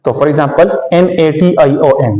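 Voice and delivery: ordinary talk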